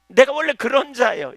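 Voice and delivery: 내가 punched high and loud in full preaching; preached confession. Acted. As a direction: proclaim 내가 원래 그런 자예요 loudly. Repeats confession, preached then calm.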